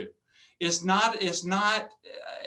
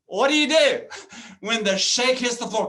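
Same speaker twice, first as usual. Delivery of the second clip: louder emphatic question; 2 semitones higher, 5 dB louder than usual. Shown as a demonstration. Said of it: "repeats the question two ways".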